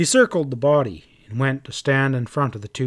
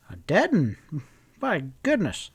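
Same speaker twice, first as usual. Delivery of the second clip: a startled, rising exclamation at the sight of a body; surprised; 3 semitones higher than usual. Acted. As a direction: blurt it out in surprise.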